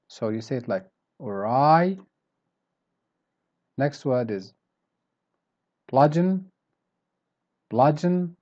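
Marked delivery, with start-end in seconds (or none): 1.2-2.0 "awry" with a weak start, rising on "wry"; stress on second syllable. Shown as a demonstration. Teacher showing students the stressed letters, "awry" stressed on the end. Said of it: "because you stress on them". 5.9-8.4 "bludgeon" hit high on the first syllable; strong start. Shown as a demonstration. Teacher modelling how to pronounce each word, "bludgeon" stressed up front. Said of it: "start strong and then you go down".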